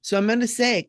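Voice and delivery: ordinary talk, nothing marked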